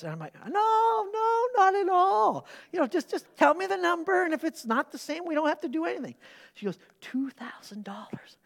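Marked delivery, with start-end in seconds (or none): 0.3-6.2 high, overly sweet reassurance to the wife; sing-song innocence, nothing to hide; mock placating. Husband soothing his wife before she names the number, high and sugary. 7.0-8.4 soft breathy whisper of the amount. Retold or imitated. Wife whispering the amount during the offering, hushed. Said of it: "she whispers to me".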